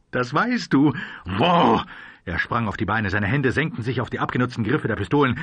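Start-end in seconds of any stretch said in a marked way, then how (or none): 1.2-1.8 raised voice, insistent; urgent demand. A narrator's reading. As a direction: demand it impatiently. Gunslinger urgently demands the answer.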